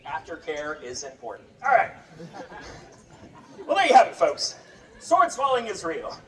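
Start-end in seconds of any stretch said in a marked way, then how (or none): none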